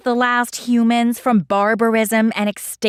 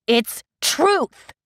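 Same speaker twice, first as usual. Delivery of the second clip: shouting; 5 semitones higher than usual. Acted. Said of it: "Edda shouts".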